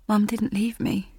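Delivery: usual speaking voice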